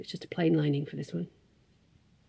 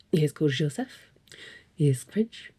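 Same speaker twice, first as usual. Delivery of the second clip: lightly put-on mock French accent; a hint of a hammed-up French accent; bad French accent; 4 semitones lower than usual. Voicing her husband's French D&D character, bad French accent. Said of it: "That is a terrible accent".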